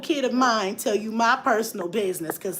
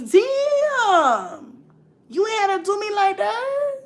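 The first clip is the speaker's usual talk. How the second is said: mock offended; 10 semitones higher, much slower than usual.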